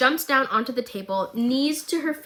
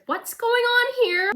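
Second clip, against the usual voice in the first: much higher and slow, mock alarm; bewildered; 13 semitones higher, slower than usual. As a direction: squeak it out, baffled. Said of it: "I said".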